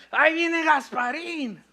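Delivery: high, loud, slow mock panic; drawn-out frightened announcement; mock fright. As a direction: cry out as a spooked disciple. Disciples crying out about ghost.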